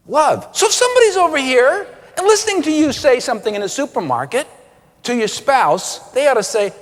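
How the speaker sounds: ordinary delivery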